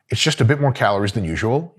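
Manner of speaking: normal talking voice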